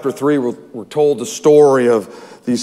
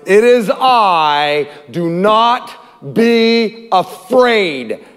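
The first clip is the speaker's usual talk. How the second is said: slow, stretched-out proclamation; declaring Jesus' words; 3 semitones higher, much slower than usual. Acted. Preacher quotes Jesus to the congregation, slow and emphatic. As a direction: draw each word out solemnly. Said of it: "But Jesus said to them".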